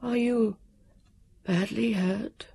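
soft, hesitant high man's voice; timid fluting voice, halting and soft; high, quavering, nervous. A narrator's reading. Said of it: "it was high and quavering and nervous".

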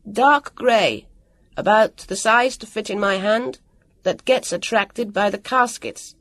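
measured call to someone distant; clear, precise call. A narrator's reading. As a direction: call out the description distinctly. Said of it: "clearly and precisely".

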